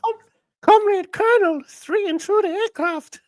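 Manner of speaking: put-on very high Czechoslovakian woman's accent; Dana accent. Comic character reporting to her colonel, in a high Czechoslovakian accent. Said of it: "Should I do a Dana accent?".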